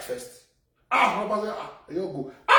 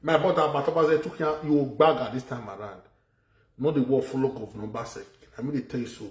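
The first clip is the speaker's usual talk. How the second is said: ordinary talk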